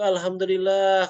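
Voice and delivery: high, loud and slow grateful cry; joyful thanks. Retold or imitated. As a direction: drag it out in relieved joy. Friend returns calling out happily.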